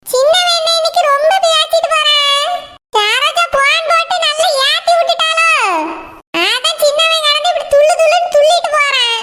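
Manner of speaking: a grandmother muttering her matchmaking plan; scheming old grandmother. Acted.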